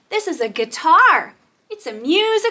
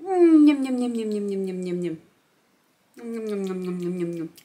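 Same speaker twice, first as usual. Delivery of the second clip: playful yum and nom noises, sinking lower and softer; pretend munching; 6 semitones lower, 4 dB quieter, much faster than usual. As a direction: gobble in play, dying away to a murmur. Pretends to eat the apple.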